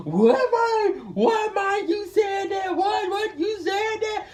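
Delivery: high, loud, hammed-up outrage; mock shock. Mocking the boys acting shocked at what he said, in a high loud squeal. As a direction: squeal it out in fake disbelief.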